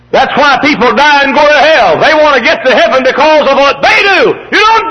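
high, loud hellfire shout; fiery pulpit cry; full-force preaching. Real, not acted. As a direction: warn the congregation in a high shout.

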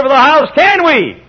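acted exasperated question; challenging objector. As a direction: fire off a listener's challenge. Voices listener's challenge.

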